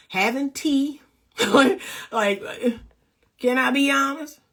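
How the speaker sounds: normal talking voice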